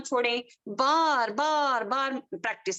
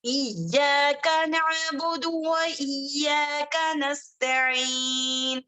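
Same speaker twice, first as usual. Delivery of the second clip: slow, melodic tajwid recitation; Quran recitation; much slower than usual. Acted. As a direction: recite the verses with care. Recites Fatiha.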